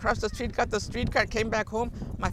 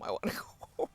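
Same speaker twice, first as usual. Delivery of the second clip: breathy whispered repeat, fading out; whispered plea; 8 dB quieter, much faster than usual. Retold or imitated. Repeating to her friend in a mortified whisper. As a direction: let the second I wanna go home collapse into a whisper.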